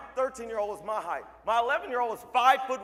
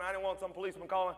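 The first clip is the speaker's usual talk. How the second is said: ordinary talk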